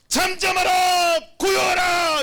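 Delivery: high, loud, drawn-out cry; commanding shout. Acted. Preacher voicing Jesus commanding the storm, sustained shout. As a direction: shout down the wind and waves. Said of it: "이렇게 외치시는 거예요".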